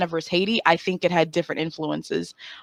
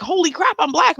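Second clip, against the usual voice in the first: higher, startled realization; mock shock; 10 semitones higher than usual. Girl realizing in the mirror, higher mock shock. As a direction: gasp it out in the mirror. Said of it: "I was like".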